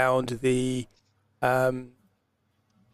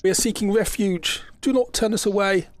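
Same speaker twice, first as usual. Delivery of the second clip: pleading crowd; 6 semitones higher than usual. Acted. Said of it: "hear 'em saying".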